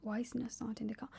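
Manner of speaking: quick hushed worried thought; rapid whisper to herself; whispered inner thought. Retold or imitated. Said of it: "and I'm like".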